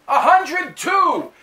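slow, high, loud shout of the number; yelled number. Retold or imitated. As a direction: belt it out with full confidence. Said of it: "I said".

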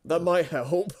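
laughing reply. Real, not acted. Laughing at joke about needing younger members.

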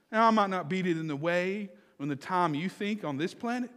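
usual speaking voice

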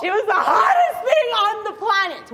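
high, emphatic near-shout; gushing excitement. Real, not acted. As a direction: proclaim it, completely swept away. Gushing climax of her story.

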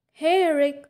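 a light call across the lot. A narrator's reading. Bella spotting Eric at her truck, calling out lightly. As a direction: call out to him as you walk up. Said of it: "I called".

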